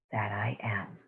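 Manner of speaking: fading into a breathy whisper. Acted. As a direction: let the last words dissolve into a whisper. Meditation's final whispered words.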